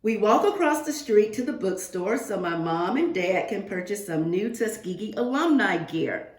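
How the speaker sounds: ordinary talk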